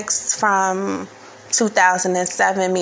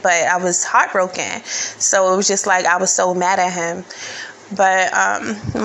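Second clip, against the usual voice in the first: normal talking voice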